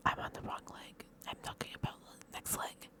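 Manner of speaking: soft whisper to herself; whispered aside. Real, not acted. Vlogger catching her own mix-up, whispering it aside. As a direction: whisper the correction under your breath.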